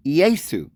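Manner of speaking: Yesu as YAY-soo, two syllables; Yesu: ee-AY-soo, falling. Shown as a demonstration. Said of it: "But it's spoken".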